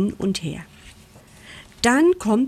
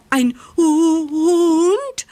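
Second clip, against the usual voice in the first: loud, high, drawn-out stammer; frightened stutter; 9 semitones higher, 6 dB louder, much slower than usual. A narrator's reading. Mole learns he faces a dog, stuttering in fright. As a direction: stammer upward in rising alarm. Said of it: "stottert Moritz".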